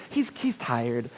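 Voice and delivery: smooth it over gently, slowly; mom excusing son. Retold or imitated. Mom covers for her son to his girlfriend, soft and apologetic. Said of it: "He's like".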